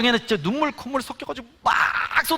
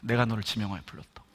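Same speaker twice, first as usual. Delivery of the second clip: much lower, softer solemn divine voice; a hushed, lowered voice of God; 11 semitones lower, 8 dB quieter than usual. Retold or imitated. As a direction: intone it quietly as God calling. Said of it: "하나님이 지금 이 순간에 말씀하고".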